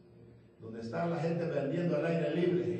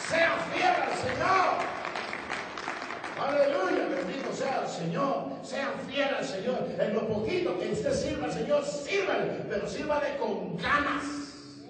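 higher, louder proclamation; full-force preaching; 6 semitones higher, 4 dB louder than usual. Acted. Preacher to the crowd in the plaza, full-force preaching.

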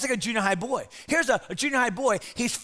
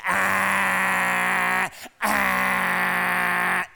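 a croaky, breaking adolescent groan; cracking teen grunt; 3 semitones lower than usual. Retold or imitated. Imitating a teenage boy whose voice is breaking, cracking groan. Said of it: "his voice sounds like it's stuck between radio stations".

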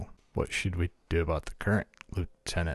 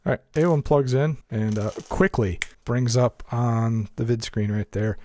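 louder, higher scene narration; narrator voice; 6 semitones higher, 7 dB louder, slower than usual. A narrator's reading. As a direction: set the scene with energy. Narrates droid action.

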